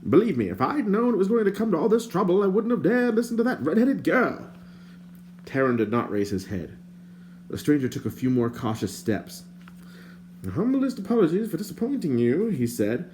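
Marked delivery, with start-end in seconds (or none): 0.1-4.5 higher, prim and indignant stranger; lightly voiced fussy stranger. A narrator's reading. 10.5-12.5 higher, prim and indignant stranger; lightly voiced fussy stranger. A narrator's reading.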